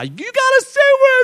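very high, garbled scream; hammed-up frantic scream dissolving into nonsense. Rider screaming at the laughing operator, garbled high scream. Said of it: "I'm screaming at this guy".

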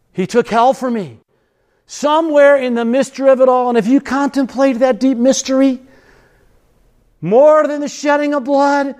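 high-pitched, sustained preaching voice; fervent preaching. Real, not acted. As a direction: preach it as a mystery that overwhelms you. Preaches on the cross.

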